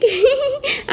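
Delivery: squeaky high-pitched giggle; mischievous high giggle. Acted. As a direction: giggle at the thought of the prank. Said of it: "ভেবেই খুব হাসি পাচ্ছে".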